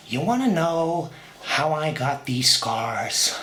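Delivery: slow, low Joker voice with long pauses; a hint of the Joker, dragged out and menacing. Slow menacing Joker telling how he got his scars. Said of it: "Heath Ledger's amazing Joker".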